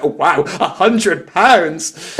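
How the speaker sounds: slow, hammed-up stage gasp; musical theatre voice. Shown as a demonstration. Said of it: "the same musical theatre".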